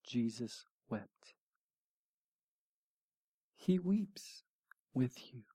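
slow, very soft reverence; almost whispered comfort; hushed, moved. Real, not acted. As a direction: drop low and soft, unhurried. Preacher lands the comforting point.

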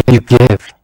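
usual speaking voice

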